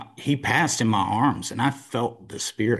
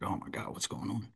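hushed, breathless whisper; 14 dB quieter than usual. Retold or imitated. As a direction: whisper it in shock. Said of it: "I'm like".

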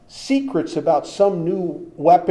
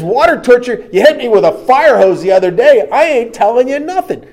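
loud, scornful tough-guy taunt, much louder; cocky macho spy; 9 semitones higher, 13 dB louder than usual. Retold or imitated. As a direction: laugh off the threat like a hardened spy. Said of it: "He says".

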